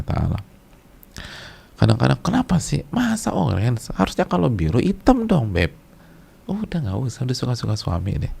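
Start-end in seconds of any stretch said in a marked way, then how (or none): 2.2-5.7 mock high whiny complaint, much higher; whiny wife protest. Istri protes ke suami. 6.5-8.3 resigned aside. Pretend. Menasihati diri sendiri.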